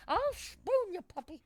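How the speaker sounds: high silly threat; playful high voice. Pretend. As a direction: coo it like a joke threat. Meme voice to the dog.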